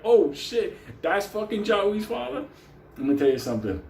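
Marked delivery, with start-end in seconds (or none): 0.1-2.5 much higher and excited; shocked realization. Retold or imitated. The narrator seeing it's Jia Wei's father, higher and excited. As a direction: realize who he is all at once. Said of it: "I was like".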